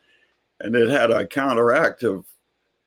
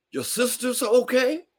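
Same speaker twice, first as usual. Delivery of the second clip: much higher question; old neighbor asking; 14 semitones higher than usual. Retold or imitated. Neighbor asks after the sisters, high question. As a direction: ask it high and light.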